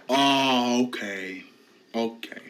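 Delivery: ordinary talk, nothing marked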